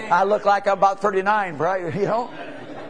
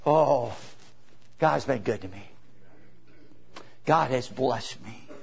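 tender gratitude; 9 semitones lower, 8 dB quieter than usual. Real, not acted. Old preacher thanking God before his congregation, hushed and sincere. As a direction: let it drop into quiet awe. Preacher reflects on seventy years.

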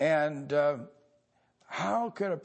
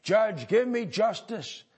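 mock plea to judge; 7 semitones higher, 6 dB louder than usual. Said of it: "stand before the judge and say".

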